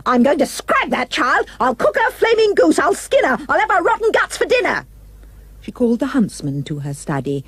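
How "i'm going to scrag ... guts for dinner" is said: much higher, furious shriek; screeching furious queen, speeding up at the end; queen yelling. A narrator's reading. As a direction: rage like a wild queen. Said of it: "She yelled".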